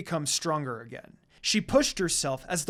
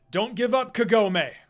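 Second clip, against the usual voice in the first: calling out; 5 semitones higher, 6 dB louder than usual. A narrator's reading. Ghostly father calls out encouragement.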